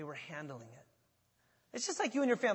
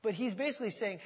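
normal talking voice

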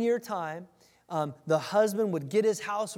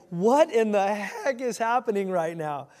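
a hint of a flustered teen; confused Mary; 3 semitones higher, 6 dB louder than usual. Mary's confused reaction voiced.

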